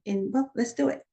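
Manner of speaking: ordinary delivery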